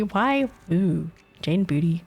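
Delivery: ordinary delivery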